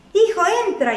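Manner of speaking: high, pleading call to the son; coaxing father. Acted. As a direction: gently urge him to come in. Father coaxes son to come in.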